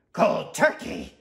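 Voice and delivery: sweet tooth recoiling loudly; appalled outburst. Acted. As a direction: repeat it in horrified disbelief.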